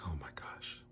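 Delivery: hushed, lightly imitated tearful whisper; crying. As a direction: softly mimic someone overcome with tears. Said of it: "she's crying".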